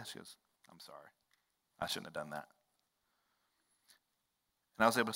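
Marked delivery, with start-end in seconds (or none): 0.7-2.5 very soft, low, sheepish admission; retold soft apology. Sister apologizing on the drive home, hushed and low. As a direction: apologize quietly, voice dropped right down.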